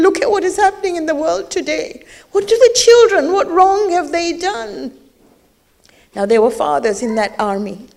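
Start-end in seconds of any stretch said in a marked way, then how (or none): 0.0-4.9 high voice cracking with sobs; tearful lament. Acted. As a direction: mourn the children aloud. Preacher laments children today.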